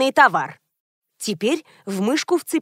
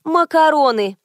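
higher, stretched-out proclamation; announcing; 5 semitones higher, 4 dB louder than usual. A narrator's reading. Cashier Sveta proclaims the product to customers, announcing. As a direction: proclaim it like a herald. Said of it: "возвестила Света".

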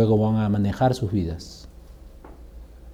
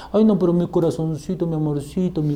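higher cooing baby-talk, imitated; mock doting parent; 3 semitones higher, 4 dB quieter than usual. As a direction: coo it like an indulgent parent. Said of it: "como dicen ustedes". (mimos de padre consentidor, imitados)

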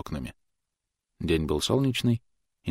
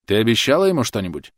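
louder, higher, pressing on ему; insistent repeat; 4 semitones higher, 6 dB louder than usual. A narrator's reading. Thomas repeating the question to Clotilde, insistent. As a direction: push the question again.

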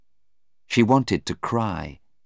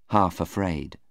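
ordinary delivery, nothing marked

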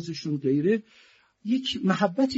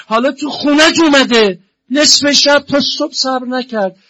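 higher, louder, indignant sermon voice; preaching at full force; 11 semitones higher, 11 dB louder than usual. Acted. Preacher lamenting to mourners, in a raised voice. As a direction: cry out against the injustice from the pulpit.

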